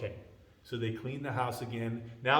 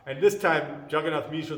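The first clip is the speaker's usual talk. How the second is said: usual speaking voice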